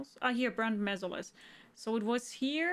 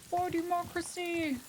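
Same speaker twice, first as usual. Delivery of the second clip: mock battle cry; 6 semitones higher than usual. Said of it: "They say it a lot in the game".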